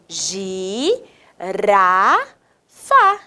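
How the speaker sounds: slow three-beat syllable demo; three separate pieces. Shown as a demonstration. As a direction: separate "gi, ra, fá" with pauses. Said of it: "ela tem três pedacinhos".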